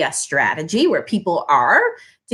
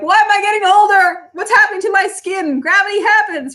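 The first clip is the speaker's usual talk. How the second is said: high, louder panicked whine at aging; mock dismay; 9 semitones higher, 4 dB louder than usual. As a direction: whine in mock horror at your own skin. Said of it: "you're like".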